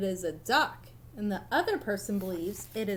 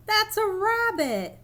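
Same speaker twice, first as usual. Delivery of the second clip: high, slow insistent correction; insisting rabbit voice; 10 semitones higher than usual. Acted. Rabbit believer corrects the duck believer, insistent. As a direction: set them straight, stretched out.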